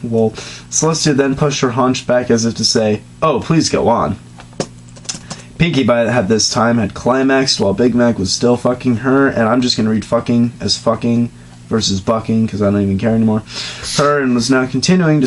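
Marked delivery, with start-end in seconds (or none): none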